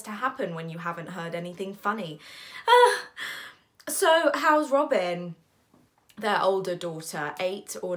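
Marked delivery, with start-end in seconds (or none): 2.7-5.3 high, loud, stretched-out question; forced small talk. Acted. Narrator asking brother after his daughter, forced bright interest. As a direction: feign interest with effortful brightness.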